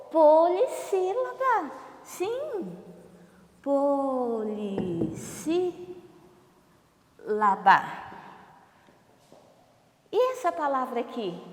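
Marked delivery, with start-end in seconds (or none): 0.1-2.7 high, slow pupil voice; pupil's answer. Acted. Pupil answers what five syllables are called, high put-on voice. 3.6-7.8 POLISSÍLABA stretched into po-li, sí, la-ba with long pauses; syllable by syllable. Shown as a demonstration. Teacher confirms the term to pupils, "Polissílaba" syllable by syllable. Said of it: "como que nós classificamos?".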